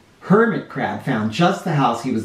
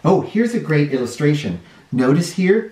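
ordinary delivery, nothing marked

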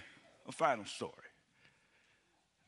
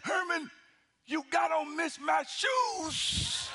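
higher, slow, drawn-out shout; excited exclamation; 6 semitones higher, much slower than usual. Retold or imitated. God sister spotting his mismatched shoes, a high slow shout. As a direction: blurt it out in shocked amusement.

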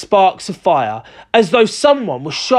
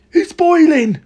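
very high, thrilled exclamation; excited cry; 12 semitones higher, 3 dB louder, slower than usual. A narrator's reading. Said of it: "cried Mister Cranky".

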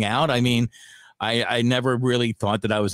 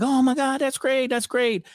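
high-pitched delighted reaction, re-enacted; retold delight; 11 semitones higher than usual. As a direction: replay being thrilled at good news, voice lifted high. Retelling his joy at baby news.

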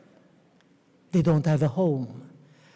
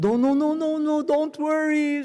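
a lightly voiced old professor, higher and brushing it off; higher reassuring old teacher; 9 semitones higher than usual. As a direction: wave away the apology kindly, pitched up. Old professor waving off the apology.

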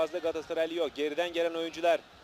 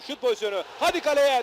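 rising excitement; 10 semitones higher, 8 dB louder than usual. Real, not acted. Attack building toward goal.

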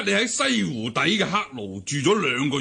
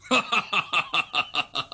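pleased laugh; 3 semitones higher than usual. Acted.

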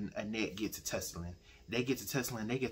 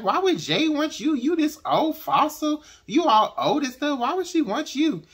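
mocking comment; 11 dB louder than usual. Nosy relative mocks the wife's age, high mocking taunt. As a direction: jeer at her like a nosy old woman. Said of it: "she makes this comment".

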